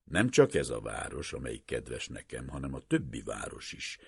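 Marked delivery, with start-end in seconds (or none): none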